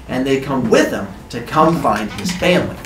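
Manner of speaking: ordinary delivery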